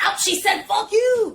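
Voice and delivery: high, mocking tattletale voice; high put-on voice of a scandalized busybody; mocking gossip. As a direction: mimic a shocked gossip repeating a curse. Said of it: "and they're like".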